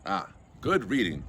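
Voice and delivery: usual speaking voice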